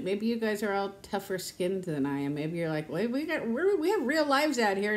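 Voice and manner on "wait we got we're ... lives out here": imitating an indignant viewer, pitched up; mock scolding viewer. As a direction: put on a protesting voice.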